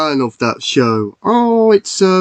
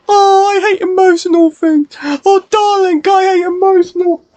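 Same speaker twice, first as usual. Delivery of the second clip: much higher squeaky toy voice, whiny; high character voice; 3 dB louder than usual. Acted. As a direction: squeal that you hate emotional films. High character dislikes sad films.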